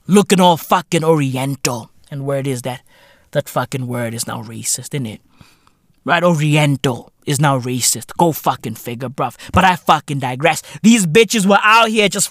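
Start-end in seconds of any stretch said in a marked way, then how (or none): none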